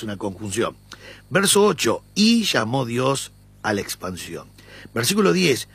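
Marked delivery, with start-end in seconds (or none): 2.2-4.5 "Y" stressed and slowed. Shown as a demonstration. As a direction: lean on the "Y", read slowly. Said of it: "Yo voy a darle énfasis en la "y". (la "Y" enfatizada y lenta)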